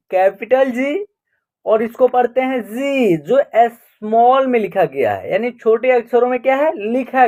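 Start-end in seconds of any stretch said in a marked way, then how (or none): none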